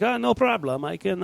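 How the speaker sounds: a hammed-up German scientist accent; playful German accent, light and jokey. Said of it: "that's my German accent".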